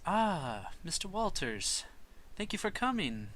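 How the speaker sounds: high, bright, formal lady's greeting; proper professor welcoming the father in. Acted. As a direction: greet him as a gracious hostess. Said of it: "and she goes".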